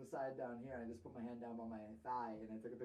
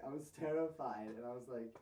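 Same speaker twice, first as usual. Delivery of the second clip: normal talking voice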